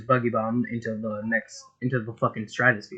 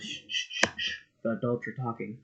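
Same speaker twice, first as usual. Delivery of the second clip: hushing condescension; 7 dB quieter than usual. Acted. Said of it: "looks at him and says".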